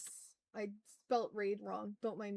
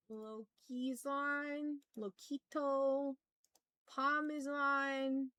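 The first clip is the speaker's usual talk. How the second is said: slow drawl; 3 semitones higher, much slower than usual. Real, not acted. Slowly reading friends online.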